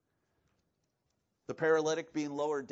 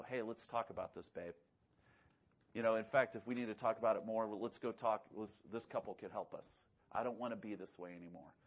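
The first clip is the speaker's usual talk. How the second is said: lowered, softer husband to wife; soft sincere plea; 8 semitones lower, 7 dB quieter than usual. Retold or imitated. Husband quietly asking his wife to talk it through, tender. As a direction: gently ask her to talk it out. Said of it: "you kinda go".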